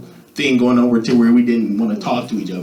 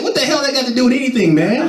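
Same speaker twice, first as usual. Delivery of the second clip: exasperated mock; 10 semitones higher, faster than usual. Exasperated at friends.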